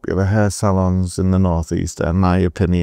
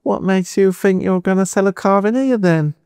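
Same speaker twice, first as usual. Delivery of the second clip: much higher put-on Cockney geezer voice; mock Cockney boss, pitched way up and teasing; Cockney accent; 11 semitones higher than usual. Cockney director quizzing the young job applicant, high mock Cockney accent. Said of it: "Andy, the Cockney".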